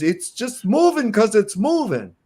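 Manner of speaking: mocking Dell's answer. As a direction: parrot his lazy answer back, sneering a little. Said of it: "all he could say was".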